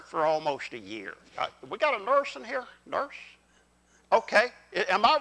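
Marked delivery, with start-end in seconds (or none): none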